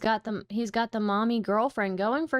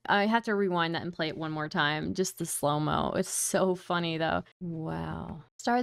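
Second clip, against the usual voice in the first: usual speaking voice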